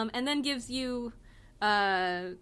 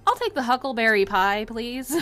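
Elle ordering; 3 semitones higher, 8 dB louder than usual. Acted. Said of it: "Elle looks up at the waitress and goes".

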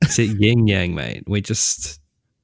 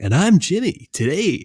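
higher, louder peppy intro; Jimmy impression; 9 semitones higher, 3 dB louder than usual. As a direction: bounce it up like an overexcited host. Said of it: "That's my Jimmy impression".

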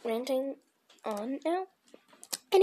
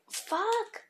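high yelp of a curse; sudden high frustrated curse; startled curse; 9 semitones higher than usual. Real, not acted. Paint mistake.

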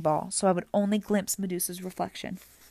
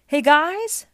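high, loud call down; yelled from above; 9 semitones higher, 9 dB louder than usual. A narrator's reading. Grover flies overhead calling his friends, yelling. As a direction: holler to friends below. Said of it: "Grover yelled somewhere above us".

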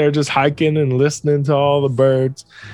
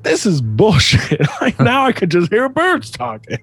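higher, griping comic complaint; mock exasperation; 9 semitones higher than usual. Kid fed up after a month listening, mock exasperation. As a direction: gripe it, climbing higher as you go. Retold kid about to give up.

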